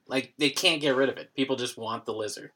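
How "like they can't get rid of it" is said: ordinary talk, nothing marked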